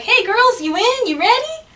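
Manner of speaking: lightly voiced dad rallying his kids; imagined dad. Dad coming round the corner to the girls, playful call. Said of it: "the dad was gonna walk around the corner and be like".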